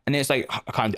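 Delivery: usual speaking voice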